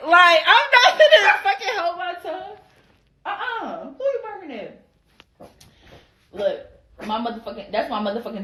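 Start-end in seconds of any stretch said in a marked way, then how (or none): 0.1-4.8 high, sassy clapback; squeaky-high indignant comeback; high-pitched retort. Retold or imitated. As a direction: pitch it way up in mock outrage. Acted retort in her confrontation story.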